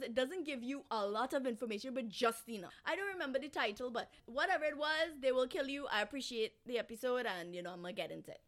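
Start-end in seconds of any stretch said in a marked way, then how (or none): none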